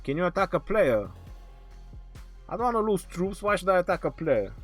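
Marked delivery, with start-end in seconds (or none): none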